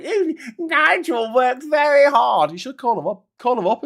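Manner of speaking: high, slow, gushing toff impression; loud, drawn-out mocking simper. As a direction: coo it like a fawning upper-class admirer. Mimicking the toff praising Nigel.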